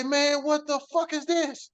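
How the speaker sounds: slow, high-pitched retold bafflement; retold disbelief. Man retelling his shock at the papers, incredulous.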